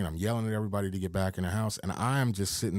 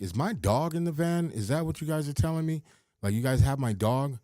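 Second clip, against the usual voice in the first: anxious questions; 7 semitones higher than usual. Retold or imitated. Frantic owner questioning police.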